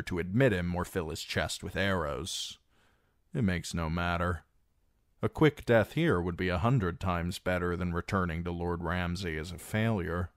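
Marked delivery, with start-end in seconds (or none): none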